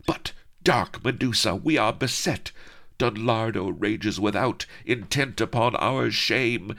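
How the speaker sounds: lightly voiced desperate damsel; pleading maiden. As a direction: beg for aid, rising.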